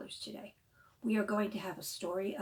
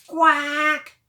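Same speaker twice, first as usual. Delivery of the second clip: loud, higher quack; duck quack; 7 semitones higher, 17 dB louder than usual. Acted. Librarian voicing the duck for children, loud quack.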